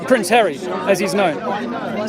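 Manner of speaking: ordinary talk, nothing marked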